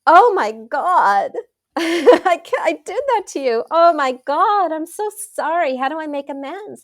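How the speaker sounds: hammed-up shock and sorry; dismayed apology. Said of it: "And then I felt like".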